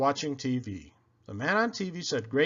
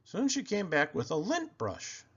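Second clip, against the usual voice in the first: ordinary delivery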